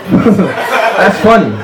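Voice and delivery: stretched-out laugh that turns into words; a slow, self-conscious chuckle from the corner; slow forced laugh. Pretend. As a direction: laugh slowly, then say it through the laugh.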